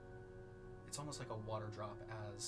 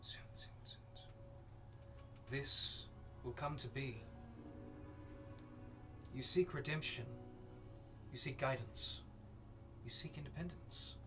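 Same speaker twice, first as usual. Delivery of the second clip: whispered then rising ominous voice; whisper, then swell with menace; eldritch horror; 3 semitones higher than usual. Acted. Voice from the flames speaks.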